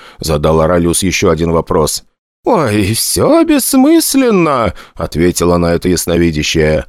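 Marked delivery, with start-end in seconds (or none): 2.4-4.7 high, slow theatrical fortune-teller voice; prophetic trance voice. A narrator's reading. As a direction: intone it like a stage seer. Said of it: "хорошо поставленный ... голос прорицательницы".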